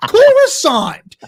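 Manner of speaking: high, strained, half-laughing protest; exasperated Dooku. Retold or imitated. Dooku refusing Palpatine, high exasperated laughing protest. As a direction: squeal out the objection in mock despair. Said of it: "He's like".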